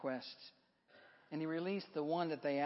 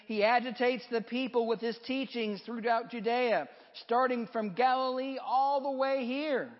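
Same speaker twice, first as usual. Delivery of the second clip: raised pitch, pressing accusation; strenuous objection; 6 semitones higher, 5 dB louder than usual. A narrator's reading. Crowd objecting to Pilate about Jesus, strenuous protest. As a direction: object forcefully, voice pitched up and louder. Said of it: "they objected strenuously".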